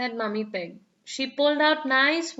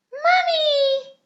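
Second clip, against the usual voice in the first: high, loud call; 5 dB louder than usual. A narrator's reading. Peppa calls Mummy to announce the trip, high and loud. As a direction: cry out for Mummy. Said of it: "cried Peppa".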